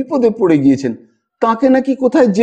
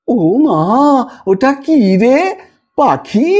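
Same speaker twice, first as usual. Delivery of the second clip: higher, slow, with a sob still in it; coaxing wonder; 4 semitones higher, much slower than usual. A narrator's reading. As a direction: distract the child with a bird. Distracting the crying child. (পাখি দেখিয়ে শিশুকে ভোলানো)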